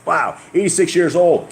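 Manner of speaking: amazed. Real, not acted. Amazed at Abram's age.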